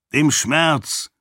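put-on a young prince's angry outburst. A narrator's reading. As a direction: snap back the words in anger. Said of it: "sagte Raoden wütend".

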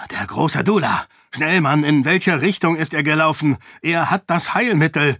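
loud, high, hurried and urgent; put-on breathless urgency; pretending to be out of breath and frantic; faked exhaustion. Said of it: "betont heftig zu atmen, als sei er völlig erschöpft".

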